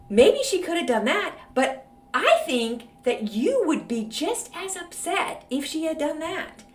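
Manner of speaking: ordinary talk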